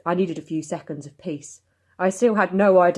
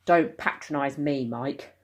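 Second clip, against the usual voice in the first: ordinary delivery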